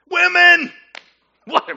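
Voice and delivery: high, loud hoot breaking into laughter; hammed-up crowd guffaw; mock hilarity. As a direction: shout it out, then crack up. Crowd hoots at the wife excuse.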